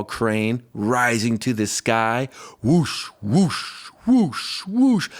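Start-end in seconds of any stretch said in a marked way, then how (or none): none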